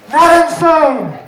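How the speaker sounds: loud, slow, emphatic shout. Acted. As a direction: belt out the final line. Punchline shout ending the poem.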